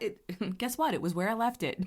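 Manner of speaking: usual speaking voice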